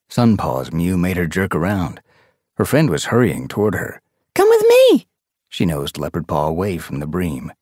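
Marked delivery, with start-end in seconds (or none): 4.3-5.1 put-on high, loud young she-cat; eager summons. A narrator's reading. Sun Paw pulls her friend away, high and eager. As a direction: urge her along with excitement.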